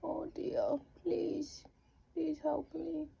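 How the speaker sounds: high, whispery, helpless little animal; sweet wombat voice. Pretend. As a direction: plead softly like a tiny innocent wombat. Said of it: "I reckon they'd be like".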